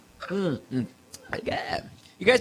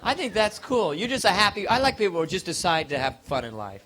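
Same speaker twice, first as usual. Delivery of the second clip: ordinary delivery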